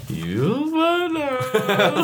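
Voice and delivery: very high, drawn-out eerie wail; eerie local chorus. Retold or imitated. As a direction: stretch it out in a spooky high voice. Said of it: "And they were like".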